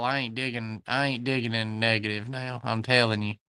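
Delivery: normal talking voice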